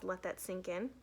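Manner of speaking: plain reading voice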